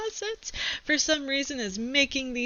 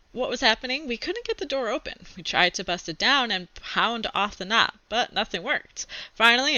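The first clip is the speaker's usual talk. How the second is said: put-on reading a chapter book aloud to kids; steady read-aloud storytelling; story narration; 3 semitones higher, 4 dB louder than usual. A narrator's reading. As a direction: tell it like a kid's diary read to class.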